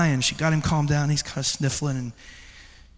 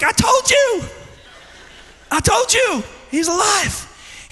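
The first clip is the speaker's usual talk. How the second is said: high, shouted exclamation; excited father, imitated; slower than usual. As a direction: shout the good news back to a child. Father exclaiming back to child.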